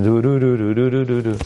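mock incantation of repeated syllables; mumbled incantation. Rabbi mocking Tehillim said as magic words, mock incantation. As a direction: chant nonsense magic syllables in a run. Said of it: "say these magical words, incantation".

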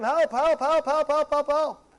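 repeated, insistent cry for help; mock screaming out. Person in a tight position pleading to Hashem, mild mock scream. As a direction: rattle off pleas like someone cornered. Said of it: "you're gonna scream out to Hashem".

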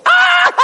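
a sudden very high yelp then a laugh; retold scream of pain. Rabbi reliving cutting his finger, high yelp and laugh. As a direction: relive the knife cut with a yelp. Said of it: "I was screaming".

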